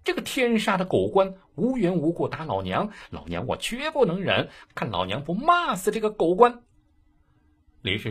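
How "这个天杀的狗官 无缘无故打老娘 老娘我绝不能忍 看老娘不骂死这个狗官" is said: a fierce shrew screaming abuse; furious ranting. Acted. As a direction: spit out every curse at the dog official. Shrew rages at the magistrate.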